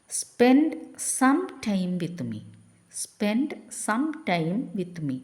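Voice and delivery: very slow, drawn-out words; slow dictation. Shown as a demonstration. Teacher reading the English prompt slowly to the class. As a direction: stretch every word. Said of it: "slow English model phrase".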